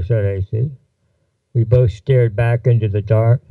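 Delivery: ordinary delivery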